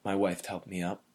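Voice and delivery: ordinary delivery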